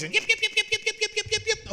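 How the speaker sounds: very high, tiny yapping barks; yipping little dog. Retold or imitated. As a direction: yap like a tiny yipper dog. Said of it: "and high-pitched voice".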